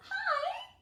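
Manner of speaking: very high, sing-out hello; high-pitched storybook hello; friendly greeting. Acted. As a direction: greet the hidden creature in a high voice. Bear greets the hidden creature.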